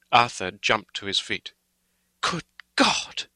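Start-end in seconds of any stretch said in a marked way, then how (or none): 2.2-3.3 high-pitched shocked outcry; crying out. A narrator's reading. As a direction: cry it out, aghast. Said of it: "he cried".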